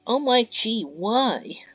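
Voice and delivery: high, loud, mocking disbelief; scornful outburst. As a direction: scoff at them like they're idiots. Mia annoyed at intruders.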